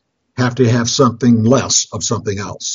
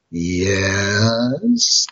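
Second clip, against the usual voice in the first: drawn-out, rising sheepish yes; coy, reluctant mock admission; 3 semitones lower, 4 dB quieter than usual. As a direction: stretch it out like a guilty confession. Wife reluctantly admits shopping to husband.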